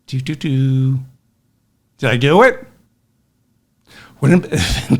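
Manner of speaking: ordinary delivery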